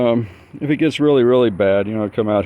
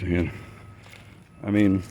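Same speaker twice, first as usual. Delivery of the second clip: ordinary delivery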